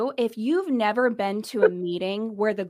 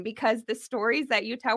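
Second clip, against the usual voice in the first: normal talking voice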